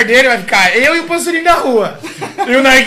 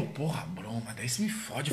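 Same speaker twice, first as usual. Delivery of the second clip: very low, barely voiced grumble; imitating a friend's muted dismay; hushed complaint; 12 semitones lower, 20 dB quieter, slower than usual. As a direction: complain under the breath, almost whispering. [name]'s acted hushed complaint.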